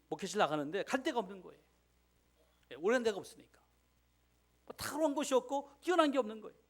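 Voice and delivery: impassioned pulpit preaching; fervent preaching. Acted. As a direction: preach it with rising fire. Preaching builds to full force.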